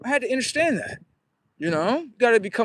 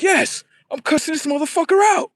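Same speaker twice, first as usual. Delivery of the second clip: higher, louder hammed-up girlfriend on the phone; mock angry girlfriend; 9 semitones higher, 3 dB louder than usual. Girl yelling at her cell phone, mock outrage. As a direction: play her cussing into the phone, overdone.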